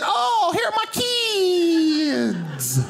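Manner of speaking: very high comic voice with long drawn-out ending; hammed-up high squeal sliding down slowly; delighted woman imitation. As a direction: mimic her joyful cry, stretching the last word. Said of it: "and she said".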